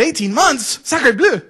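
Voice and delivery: loud, high mock-French outrage; hammed-up French shock; French accent. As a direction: explode like a scandalized comic Frenchman. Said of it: "do this in a French accent".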